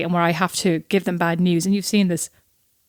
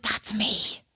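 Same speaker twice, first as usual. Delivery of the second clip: hushed, whispery aside of recognition; soft retold whisper of self-recognition; whispered realisation; 8 dB quieter than usual. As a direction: whisper it like a private discovery.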